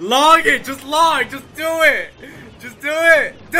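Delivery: loud, high urging shouts; urgent yelling. Real, not acted. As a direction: push him to act now. Urging partner mid-match.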